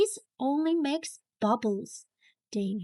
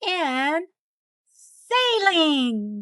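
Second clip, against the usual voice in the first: loud, drawn-out shout of saline; group shout; 4 semitones higher, 5 dB louder, much slower than usual. A narrator's reading. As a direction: shout the word together. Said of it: "we all shout".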